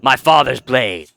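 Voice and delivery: slow solemn undead warrior; quest NPC voice. Acted. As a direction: read it like a grave undead hero. Reads quest NPC lines.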